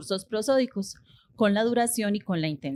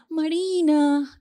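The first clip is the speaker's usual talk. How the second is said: MARINA drawn out in a high, sustained calling tune; changed intonation; 6 semitones higher, much slower than usual. Shown as a demonstration. Teacher shows students how pragmatic content changes, MARINA called out long. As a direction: call out MARINA, holding the syllables high and long. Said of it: "miren que he cambiado, eh, la entonación".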